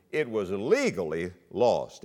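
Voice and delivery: "legally" drawn out and set apart; stress on legally. Real, not acted. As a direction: lean hard on the word "legally", taking it slowly. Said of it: "Emphasis on the word legally".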